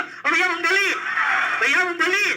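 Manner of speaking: high, sing-out hawker cry; peanut vendor's call. Acted. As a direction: call out like a street peanut seller. Acting a peanut seller calling out. (मूंगफली वाले की ऊँची पुकार)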